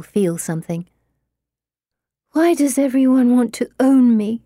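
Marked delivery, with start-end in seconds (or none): none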